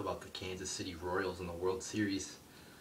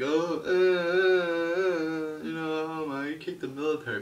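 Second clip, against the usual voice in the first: high, louder, very slow stammering mock outrage; mocking offended fans; 8 semitones higher, 6 dB louder, slower than usual. Vlogger mocking fans upset by the kick, spluttering high voice. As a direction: splutter like a scandalised viewer. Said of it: "People are like".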